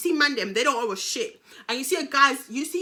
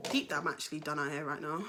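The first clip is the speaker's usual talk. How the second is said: low, much softer murmur; quiet aside; 8 semitones lower, 10 dB quieter than usual. Real, not acted. Storyteller done after her rant, quiet low aside. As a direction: drop away into a spent aside.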